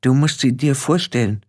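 mild stage whisper, pitched a little up; understated confiding aside. Acted. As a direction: pass on a discreet hint. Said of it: "flüsterte ihr Petulia zu".

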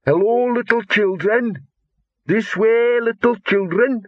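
high, drawn-out singsong call; trilling troll call. A narrator's reading. Troll luring little children out of the rubble, trilling. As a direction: trill it slowly and sweetly, far up in pitch. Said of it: "A voice a little closer now trilled".

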